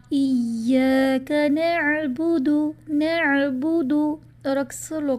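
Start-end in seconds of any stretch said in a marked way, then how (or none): 0.1-3.9 slow chanted tajweed recitation of نعبد with the dal kept short; Quranic recitation. Shown as a demonstration. Teacher recites the correct verse to students, slow and chanted. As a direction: recite نعبد slowly, dal not stretched. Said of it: "اس کی بھی example سورۃ الفاتحہ میں موجود ہے".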